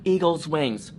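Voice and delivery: ordinary delivery, nothing marked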